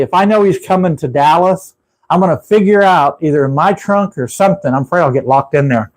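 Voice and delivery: normal talking voice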